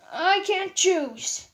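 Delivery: slow, heavy despair; sad, torn. Acted. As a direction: say it slowly, unable to decide.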